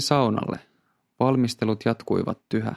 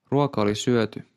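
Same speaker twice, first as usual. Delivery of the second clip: ordinary delivery, nothing marked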